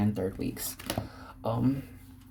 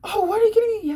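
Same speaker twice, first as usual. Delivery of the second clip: very high, louder squeal of disbelief; nine-year-old told they're going to SeaWorld, high excited shriek; shocked kid; 5 dB louder than usual. Retold or imitated. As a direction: shriek like a kid who can't believe it. Kid hearing about SeaWorld.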